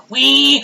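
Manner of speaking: high-pitched mock "wee". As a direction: squeal a little "wee" for toy-like cars. Mimicking little cars racing.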